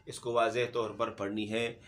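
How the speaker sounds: usual speaking voice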